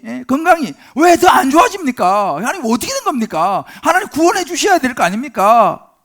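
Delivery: loud, high-pitched protest to God. Acted. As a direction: cry out demanding why, aggrieved. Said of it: "우리도 그렇게 말할 때가 있잖아요". (하나님께 억울하게 따지는 외침)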